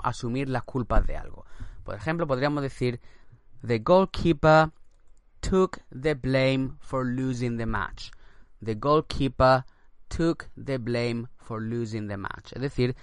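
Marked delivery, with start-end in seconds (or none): 3.6-8.1 slow English read; slow model sentence. Shown as a demonstration. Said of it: "teacher models example". 8.6-12.5 slow English repeat; slow dictation read. Shown as a demonstration. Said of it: "teacher repeats example".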